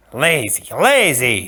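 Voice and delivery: lightly voiced scornful jab; mocking goad. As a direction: prod him with the word. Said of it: "spurred the Sicilian".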